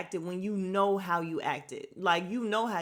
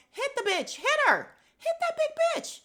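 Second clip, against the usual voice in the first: high, louder, rising shouts; egging on the fight; 5 dB louder than usual. Real, not acted. Urging Kandi to hit Marlo, high excited shouting. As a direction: cheer for the hit, building up.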